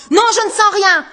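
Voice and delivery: high, loud outburst; shouted denial. Acted. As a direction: fling the denial back. Anguste denies, shouting. (Anguste nie en criant)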